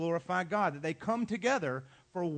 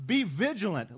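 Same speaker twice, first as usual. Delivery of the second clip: loud, higher-pitched warning; express command; 4 semitones higher, 11 dB louder than usual. Acted. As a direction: fire it out as a forceful order. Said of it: "express command".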